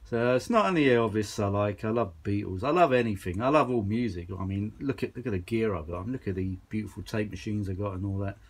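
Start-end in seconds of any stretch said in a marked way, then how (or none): none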